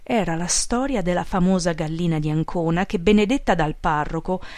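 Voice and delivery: plain reading voice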